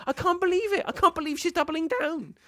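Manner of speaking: high-pitched, amazed disbelief; incredulous. Real, not acted. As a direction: exclaim it high, utterly amazed. Stunned repeat after bad accent.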